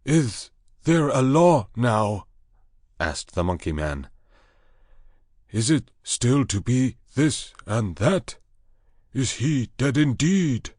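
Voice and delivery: high, slow ape man voice; anxious ape man. Acted. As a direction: squeak it out like a frightened ape man. Monkey man asks anxiously.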